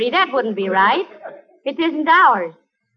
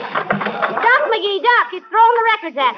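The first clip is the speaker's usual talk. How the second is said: panicked warning; 7 semitones higher than usual. Acted. Molly warning McGee as records fly at her, panicked cry.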